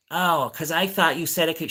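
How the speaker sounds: high put-on old lady voice; imitating repetitive neighbor. Old lady doubting neighbor's word, mimicked high. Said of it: "then she repeats herself".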